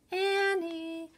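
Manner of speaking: high, sung-out calling from far off; distant call. A narrator's reading. Mom calls the kids home from a distance. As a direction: call them in for dinner from the house. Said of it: "she called".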